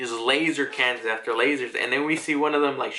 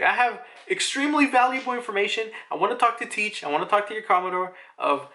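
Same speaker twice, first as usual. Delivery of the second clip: higher, put-on voice of a scheming prisoner, pushing faster at the end; pleading schemer voice; 8 semitones higher, faster than usual. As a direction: bargain eagerly as a shady captive. Character voiced begging to see Teach.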